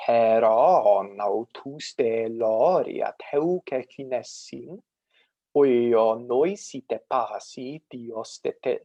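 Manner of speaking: slow, chant-like Greek verse with pitch accents; ancient Athenian recitation. Shown as a demonstration. Lecturer demonstrating Iliad's opening for students, measured ancient Greek recitation. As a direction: recite Homer slowly, drawing out long vowels and pitch glides. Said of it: "this is how a, an Athenian around the year four hundred".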